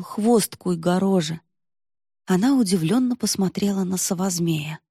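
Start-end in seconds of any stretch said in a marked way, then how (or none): none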